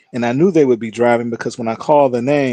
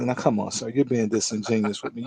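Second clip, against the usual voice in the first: softer, stern rebuke; quiet scolding; 7 dB quieter than usual. Retold or imitated. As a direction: press him softly with disbelief. Judge scolds defendant quietly.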